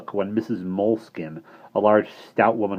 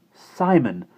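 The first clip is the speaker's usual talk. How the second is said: joyful cry; 6 semitones higher, 5 dB louder than usual. Acted. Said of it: "she cried out joyfully".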